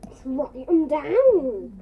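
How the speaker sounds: slow, loud and insistent; emphatic. Shown as a demonstration. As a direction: drag it out to make the point. Repeats advice slower, louder.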